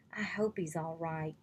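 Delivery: soft breathless whisper of worry; worried whisper. Acted. Petra whispers her fear for the king, hushed worried whisper. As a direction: breathe it out, barely voiced.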